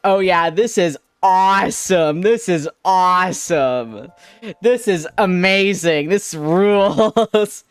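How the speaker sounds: slow, loud mock enthusiasm; sarcastic friend. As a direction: drag out fake excitement. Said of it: "he was just like".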